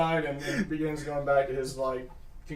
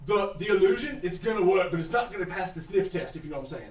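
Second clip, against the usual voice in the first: ordinary talk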